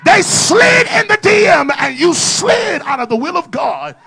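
loud, climbing preacher shout; fiery sermon delivery; full-force preaching. Acted. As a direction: preach it hard to the congregation.

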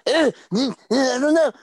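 high, loud, fed-up shouting; exasperated yell. Retold or imitated. Boyfriend losing patience at girlfriend's indecision, high exasperated shout. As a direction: pitch way up and blow up at her.

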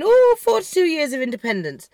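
put-on celebratory "oh", swooping high then falling; mock celebrating. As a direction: imitate a crowd's gushing celebration. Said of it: "they were all celebrating".

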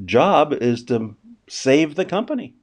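usual speaking voice

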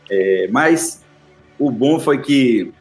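normal talking voice

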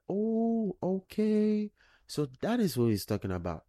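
dawning realization. Acted. Reader recalls the prophecy and understands, dawning realization.

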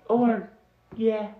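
high, uncertain little voice; hesitant messenger. Acted. Messenger answers in character.

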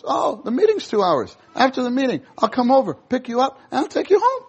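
put-on eagerly help the young person; cheerful caring brother. Brother meeting a young person heading to the movies, cheerful. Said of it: "Brother Lee gives this example".

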